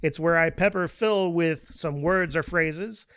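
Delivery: loud, punchy show-host intro; game segment announcer. Acted. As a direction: announce the segment with big, booming volume.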